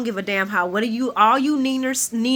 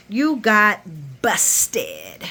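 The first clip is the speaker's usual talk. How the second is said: sassy head-twang; much slower than usual. Retold or imitated. Carly telling Nina she is caught, sassy mock gloat. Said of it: "she added that head twang".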